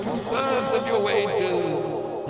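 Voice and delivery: slow spooky ghost voice; drawn-out ghostly moan; ghostly Lenin. Acted. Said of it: "this is ghostly Lenin".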